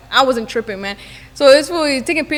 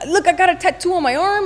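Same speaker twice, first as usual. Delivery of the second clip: higher-pitched mock backpedalling; imitated defensive excuse; 6 semitones higher than usual. Confronted man excusing his hand drop, imitated backpedalling. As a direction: wriggle out of blame, hammed up.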